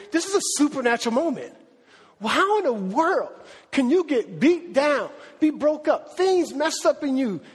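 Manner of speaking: high-pitched, urgent sermon delivery; impassioned preaching. Real, not acted. Preacher telling congregation of a beaten Paul's clarity, impassioned. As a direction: preach it with rising fervor and incredulity. Preacher builds to full force.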